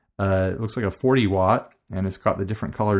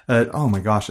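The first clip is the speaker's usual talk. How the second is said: ordinary talk, nothing marked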